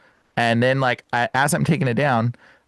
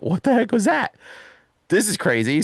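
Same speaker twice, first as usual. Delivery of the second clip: freaked-out disbelief; 8 semitones higher than usual. Retold or imitated. Hearing a Bible verse.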